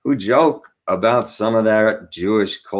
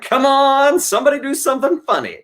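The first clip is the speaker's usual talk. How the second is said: put-on eager kid voice. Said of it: "You're like".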